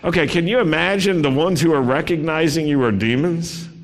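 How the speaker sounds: ordinary delivery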